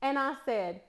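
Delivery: usual speaking voice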